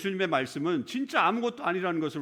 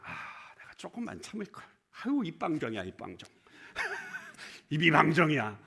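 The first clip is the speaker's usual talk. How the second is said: mock regretful sigh, soft and self-scolding; regretful Martha; 2 semitones lower, 5 dB quieter than usual. Martha regretting her words after Lazarus rises, a soft, self-scolding sigh. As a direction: sigh at your own loose tongue. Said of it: "그렇게 생각했을 거예요".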